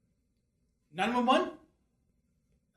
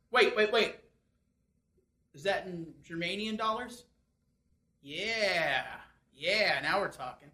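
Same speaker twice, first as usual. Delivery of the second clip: ordinary talk, nothing marked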